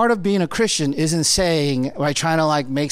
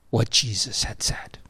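whisper; 10 semitones lower, 7 dB quieter than usual. Real, not acted. Pastor drops to a hush.

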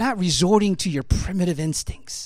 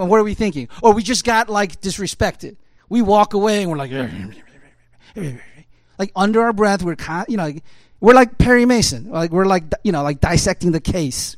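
full-force preaching; 7 dB louder than usual. Acted. Pastor rousing the church about arguments, loud preaching. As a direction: preach it out hard to the congregation. Preacher builds on audience's thoughts.

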